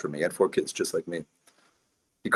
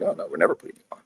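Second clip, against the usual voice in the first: much higher, airy sing-song brush-off; mocking refusal; faster than usual. Mocking the regulators refusing him airtime, with a high smug brush-off. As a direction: mimic a smug dismissal, pitched way up.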